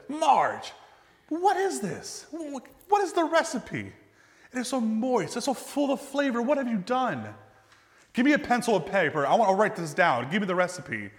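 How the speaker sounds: playing the amazed grandmother demanding the recipe; excited grandmother. Grandmother begging her friend for the recipe, high and excited.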